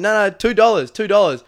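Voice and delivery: high, loud insistent haggling, repeated; mock haggling. Tourists haggling with the baker, hammed-up loud insistence. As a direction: bargain hard in a hammed-up yell. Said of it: "And we're like".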